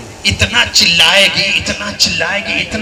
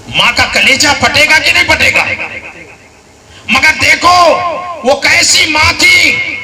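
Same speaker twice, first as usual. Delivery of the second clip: loud, high impassioned sermon; raised preacher's voice; fiery preaching; 9 semitones higher, 3 dB louder than usual. Acted. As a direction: build it up like a pulpit climax. Preacher rising to full force.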